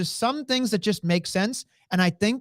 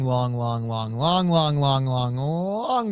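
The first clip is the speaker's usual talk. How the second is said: low, rolling string of repeats; drawn-out repetition; 6 semitones lower, much slower than usual. Real, not acted. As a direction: chain the words together low, stretching the last. Comic repetition stressing a long reign.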